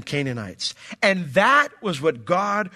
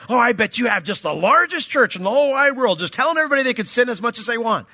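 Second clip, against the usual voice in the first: mock yell; 7 semitones higher, 5 dB louder than usual. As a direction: throw it at him like an accusation, raised and rushed. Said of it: "yelling at me in my house".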